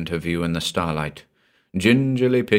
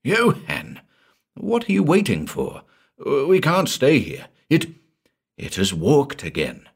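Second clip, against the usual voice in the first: much higher, panicked plea; urgent frightened pleading; 7 semitones higher than usual. Acted. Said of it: "his cousin's frightened gaze".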